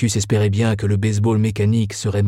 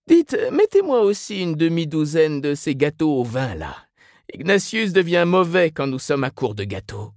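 high put-on mother's voice; chatty Mrs Reilly; 7 semitones higher than usual. A narrator's reading. Mrs Reilly orders more cakes for Ignatius, chatty. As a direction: confide in a high, homely voice.

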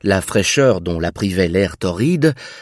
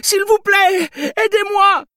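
panicked pleading. Acted. Fleeing woman begs for help.

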